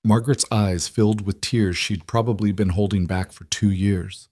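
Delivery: plain reading voice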